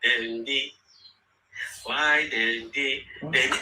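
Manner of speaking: lightly voiced mocking taunt, low and slow. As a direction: tease the rivals lazily, slow and low. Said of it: "begin to say".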